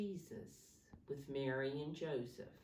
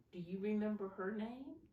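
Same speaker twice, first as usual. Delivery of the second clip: ordinary delivery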